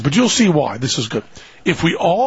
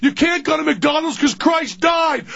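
much higher, frantic parental shouting; yelling parent; 12 semitones higher than usual. Acted. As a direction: shout the kid down in a high, strained voice. Parent yelling back at screaming kid.

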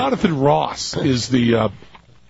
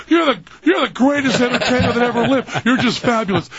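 gushing flattery; 8 semitones higher than usual. Retold or imitated. Host gushing to [name] on live air, high and eager. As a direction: fawn over him like a nervous fan. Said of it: "spend the entire six-minute interview going".

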